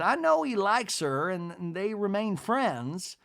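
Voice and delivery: high-pitched society matron impression; Miss Patricia. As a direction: imitate a lady gossiping in a high, lilting voice. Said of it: "Miss Patricia says".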